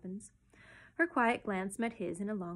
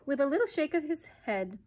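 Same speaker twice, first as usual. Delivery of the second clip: usual speaking voice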